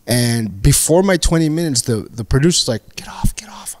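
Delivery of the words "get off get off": whispered urging from offstage; hushed, acted prompting; urgent stage whisper. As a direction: whisper it like a producer hurrying a comic. Producer hissing at comic on stage.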